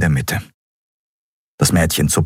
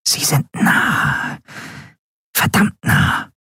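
whispered danger report; tense whisper; 10 semitones higher, slower than usual. A narrator's reading. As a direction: whisper how close they are. Whispers that pursuers are close. (angespannt flüstern)

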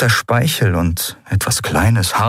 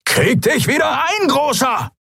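screeching shout. A narrator's reading. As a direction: screech it out at the big brute. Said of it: "kreischte Halbhorn". (kreischend, sehr hoch)

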